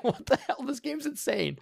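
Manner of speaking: squeaky mock outrage at the game; mock disbelief. Player reacting to crazy game.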